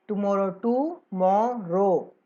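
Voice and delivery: "टुमारो" split into three slow beats, टु मा रो; syllables spelled out slowly. Shown as a demonstration. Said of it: "तीन बार बोल रहे हैं".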